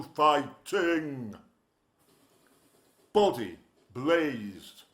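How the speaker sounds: punchy rhythmic verse recital, lines hit hard; performance poem. Acted. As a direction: rattle off the rhymes to a live crowd.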